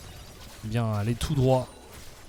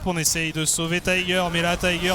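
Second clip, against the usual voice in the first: normal talking voice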